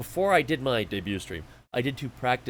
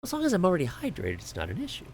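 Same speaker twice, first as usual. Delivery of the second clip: usual speaking voice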